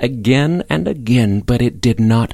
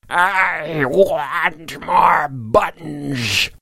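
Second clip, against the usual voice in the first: put-on high, extremely drawn-out old-creature voice; terrible old voice; 6 semitones higher, 3 dB quieter, much slower than usual. A narrator's reading. Terrible voice answers the knock at the barn, eerie old croak. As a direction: drag every word out high and eerie. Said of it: "the terrible old voice was heard again".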